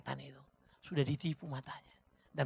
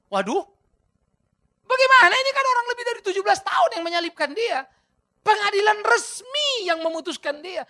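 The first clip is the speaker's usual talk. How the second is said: full-force preaching; 13 semitones higher, 12 dB louder than usual. Acted. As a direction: thunder it from the pulpit.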